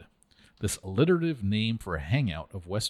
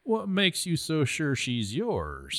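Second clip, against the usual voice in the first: slow, sneering, pitched up high; contemptuous; 7 semitones higher, much slower than usual. Acted. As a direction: scoff at him, drawn out slow. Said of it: "Johnny countered contemptuously".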